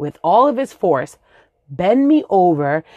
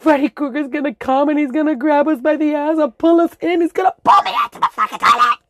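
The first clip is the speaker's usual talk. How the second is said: put-on high shrill kid yelling in a panic; panicked little kid; 10 semitones higher than usual. Little [name] warning friends about Freddy Krueger, high panicked yell. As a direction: scream it like a terrified five-year-old. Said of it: "I was just like".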